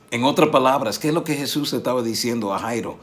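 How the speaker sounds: usual speaking voice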